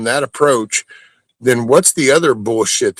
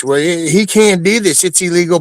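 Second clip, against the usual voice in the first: whiny mock; 6 semitones higher than usual. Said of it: "People that whine and bitch".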